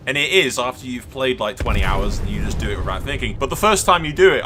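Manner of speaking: ordinary talk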